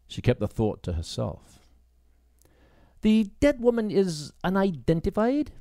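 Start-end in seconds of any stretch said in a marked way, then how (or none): 3.0-5.5 raised, questioning female voice; Grace's voice. Acted. Grace asking the constable, higher voice.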